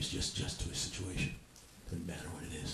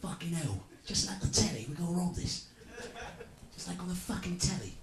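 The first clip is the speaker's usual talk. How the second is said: higher mock Cockney banter; pitched-up telly gangster accent; mock British; 7 semitones higher than usual. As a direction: ham up the London geezer. Mocking British movie dialogue.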